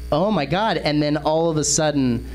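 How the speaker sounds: ordinary delivery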